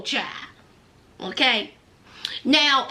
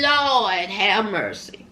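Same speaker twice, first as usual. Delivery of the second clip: lower, slow Lord have mercy; mock exasperation; 6 semitones lower, slower than usual. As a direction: drag it out, exasperated. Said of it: "I said".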